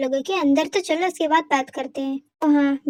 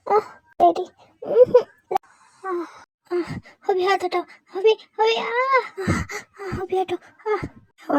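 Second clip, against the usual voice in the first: crying out in pain, gasping; pained moans; 4 semitones higher, slower than usual. Acted. Wife crying out in pain to her husband. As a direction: moan and beg him to let go.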